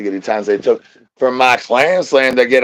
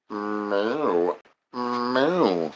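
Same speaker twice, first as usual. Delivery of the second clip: quiet mooing like a cow; soft cow moo; 2 semitones lower, 8 dB quieter than usual. Acted.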